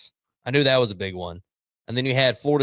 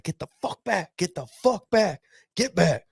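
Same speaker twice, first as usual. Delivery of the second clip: high, strained mock yell; Sarkisian; 6 semitones higher than usual. Coach ordering Texas players off the logo, mock yelling. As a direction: imitate a coach yelling players back without real volume.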